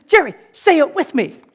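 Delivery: rally him like a coach; loud coaxing. Retold or imitated. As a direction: client urging his agent to chant along. Rod coaching Jerry on phone.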